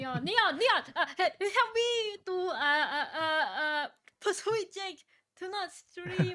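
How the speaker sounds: high, loud, half-crying plea; loud pleading. Real, not acted. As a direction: wail for help, loud and high. Begging friend for help.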